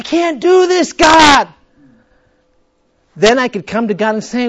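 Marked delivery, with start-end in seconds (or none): 0.0-1.5 high, loud despairing cry to God; desperate cry. Acted. Sinner crying out to God, loud and high. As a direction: cry out in defeat. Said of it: "So that we can go".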